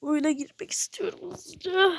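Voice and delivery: soft half-crying whisper; breathy whine of wanting; whiny whisper. Real, not acted. Impatient to join the game, whining whisper.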